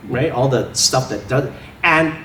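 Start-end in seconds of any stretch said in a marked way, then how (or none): none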